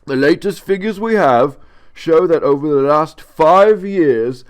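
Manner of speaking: pompous British official reading; official letter voice. Retold or imitated. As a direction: read it like a stuffy bureaucrat.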